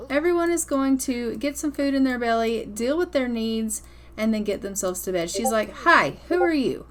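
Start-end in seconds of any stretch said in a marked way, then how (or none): 5.8-6.9 put-on high, louder child greeting; a bright young girl meeting a stranger; chirpy kid voice. Gamer voicing Tegan greeting her new uncle, higher kid voice. Voicing Sims characters' dialogue.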